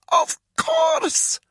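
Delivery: very high strained cry; desperate cry. A narrator's reading. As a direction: cry it out in panic. Pleading under torture.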